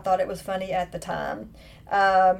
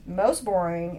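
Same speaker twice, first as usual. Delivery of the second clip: ordinary delivery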